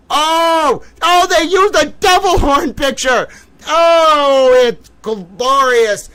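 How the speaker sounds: high, loud delighted shout, dragged out; gleeful shout. Real, not acted. Seeing the devil horn photo, gleeful slow shouting. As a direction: cry out in pure glee at the picture.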